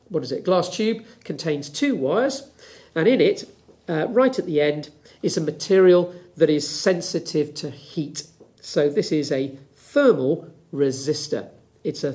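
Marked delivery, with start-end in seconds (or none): none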